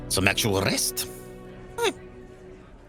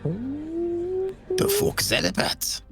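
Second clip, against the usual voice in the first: baffled; much slower than usual. Acted. Character baffled by arrest.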